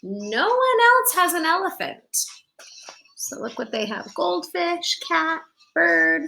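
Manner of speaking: put-on bright storybook narration for kids; gentle picture-book reading voice; reading to children. A narrator's reading. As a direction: read it like storytime on the rug. Reads picture book aloud.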